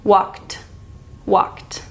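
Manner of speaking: "Walked" ending in an aspirated "t"; "Walked" with a puff of breath on the final "t"; ED as breathy t. Shown as a demonstration. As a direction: pull the tongue down and breathe out on "Walked". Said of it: "relatively relaxed".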